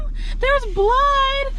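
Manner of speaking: high, slow panicked yell for Mom; girl screaming for her mom after seeing blood, panicked. Retold or imitated. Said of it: "I screamed my mom's name".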